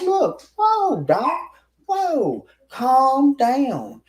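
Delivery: high, pleading placating; calming him down. Retold or imitated. The narrator pleading with the angry man to calm down. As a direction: raise your hands and soothe him.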